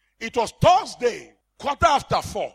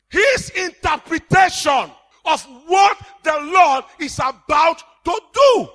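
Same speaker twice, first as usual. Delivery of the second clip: fierce rebuke; 5 semitones higher, 5 dB louder than usual. Acted. Preacher rebuking doubters in church, fierce shouted rebuke. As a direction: hammer each word home at them.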